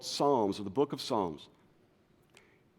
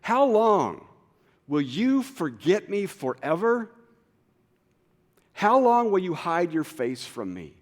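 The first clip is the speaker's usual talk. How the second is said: loud, high, slow lament; pleading psalm, raised and drawn out; anguished plea; 7 semitones higher, 8 dB louder than usual. Acted. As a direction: cry it out to God. Preacher voices anguished psalm.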